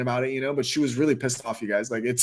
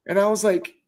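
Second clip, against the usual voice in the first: ordinary delivery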